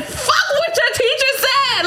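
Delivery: high, laughing mock outburst; giggling high-pitched rant; laughing defiance. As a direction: laugh it out like a fed-up mom. Mom defying teacher, laughing.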